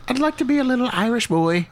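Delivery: put-on high little Irish boy voice; Irish accent. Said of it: "you'd like to do Irish".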